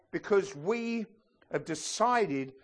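usual speaking voice

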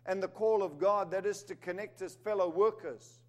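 a sermon building to full force; preaching. Acted. Preacher urging the church toward another level, rising. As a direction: lift the church toward a bigger vision.

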